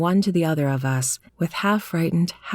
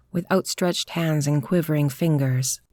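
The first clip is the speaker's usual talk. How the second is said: usual speaking voice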